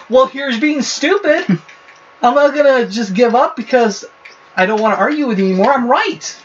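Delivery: high-pitched, fired-up retort with a laugh in it; heated retort. Retold or imitated. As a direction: argue back hot and high, half laughing. Retold heated argument with dad.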